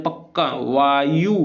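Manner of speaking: पक्का and वायु very slow, stress pressed on the first syllable; stress demo. Shown as a demonstration. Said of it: "जिसमें हम ज़्यादा pressure दे दें". (पक्का, वायु बहुत धीमे, पहले अक्षर पर ज़ोर)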